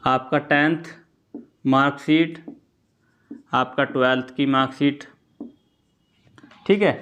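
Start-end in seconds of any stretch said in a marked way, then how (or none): none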